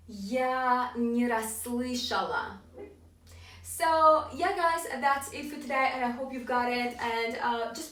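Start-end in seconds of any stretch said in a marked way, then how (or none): 0.1-2.6 very slow, drawn-out review; slow model phrase. Shown as a demonstration. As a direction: say it slowly for learners to copy. Slow final review for learners.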